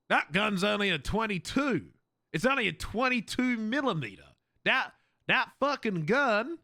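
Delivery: boastful American gun lover, slightly high; hammy American bragging about firepower; mock American accent. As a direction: scoff in a put-on American accent. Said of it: "Americans who love their guns".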